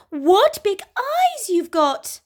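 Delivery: put-on high, slow little girl.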